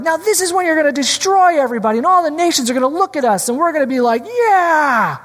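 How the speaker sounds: high, fast, gloating mock voice; excited disciples. Preacher imitates the disciples dreaming of triumph, excited. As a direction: hype it up like kids promised revenge. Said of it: "They were like".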